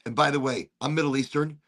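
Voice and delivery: usual speaking voice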